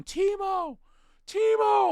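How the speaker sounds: high, loud shout from the stands; yelling a name. Retold or imitated. Fan yelling for the coach to send Timo, high and loud. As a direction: call for the shooter at full voice. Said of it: "I was yelling".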